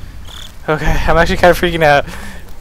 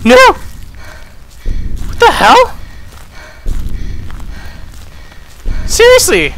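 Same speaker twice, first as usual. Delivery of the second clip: shocked outcry. Real, not acted. Player just caught by Slender, shocked outcry. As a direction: cry out in disbelief. Jump scare in horror game.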